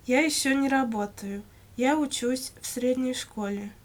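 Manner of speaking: ordinary delivery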